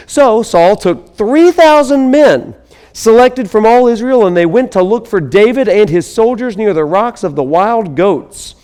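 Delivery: scripture reading. Acted. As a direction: proclaim the passage to the congregation. Reads scripture passage aloud.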